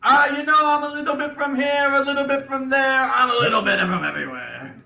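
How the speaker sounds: coy Italian dwarf dodging the question; Italian accent. Acted. Bobo answering about his French influence, coy Italian accent. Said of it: "my Italian accent".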